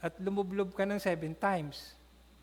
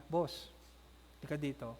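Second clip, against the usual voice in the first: hushed servant; 6 semitones lower, 8 dB quieter than usual. Acted. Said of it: "Sabi ngayon nung alipin niya".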